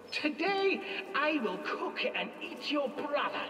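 high commanding crone; witch voice. Acted. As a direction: order her about like a witch.

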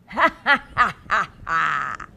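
loud playful laughing ha ha ha; mock baby laugh. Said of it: "that baby went".

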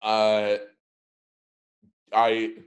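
ordinary delivery

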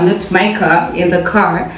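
ordinary talk

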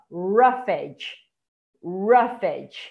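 ROUGHED as two beats, "RUF-edge"; different A sound. Shown as a demonstration. Teacher showing a totally different A, careful "RUF-edge" pronunciation. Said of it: "Esse Azinho aqui já tá totalmente diferente".

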